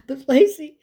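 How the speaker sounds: high, loud mock wail; hammed-up tearful interruption; hysterical protest. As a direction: whine it like a bawling friend. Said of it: "she's hysterical on the other end of the line".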